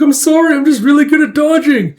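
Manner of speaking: high, loud mock-flustered voice; high-voiced apology. Woman dodging bullets, high-voiced apology. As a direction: apologise loudly while dodging.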